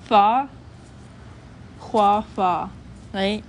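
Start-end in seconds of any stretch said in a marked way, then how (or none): 0.0-0.5 fa blown through both lips, louder; bilabial fricative. Shown as a demonstration. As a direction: push fa out through pressed lips. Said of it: "that's a bilabial fricative".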